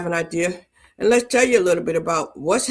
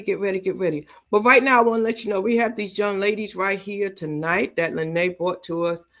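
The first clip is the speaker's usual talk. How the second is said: ordinary talk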